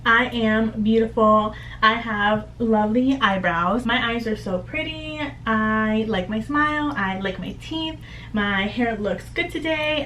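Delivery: slow, gentle self-talk into the mirror; slow mirror affirmations. Shown as a demonstration. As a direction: savour each compliment to yourself. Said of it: "you're affirming to yourself in the mirror".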